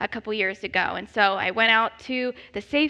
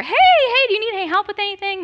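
much higher, louder and fast customer-service voice; eager manager; 9 semitones higher, 6 dB louder, faster than usual. Retold or imitated. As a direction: sing it out like an overeager store manager. Manager rushes over.

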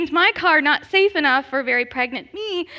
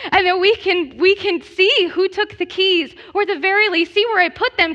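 higher, louder urgent plea; frantic pleading; 7 semitones higher, 6 dB louder than usual. Retold or imitated. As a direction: beg the manager in a panic. Pleading with the manager, panicking.

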